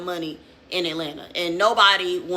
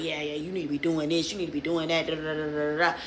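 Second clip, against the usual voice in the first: low, soft dismissive imitation of a lecture; mock lecturing; 5 semitones lower, 7 dB quieter, faster than usual. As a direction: rattle off his advice as droning blah-blah. Retelling officer's tedious advice.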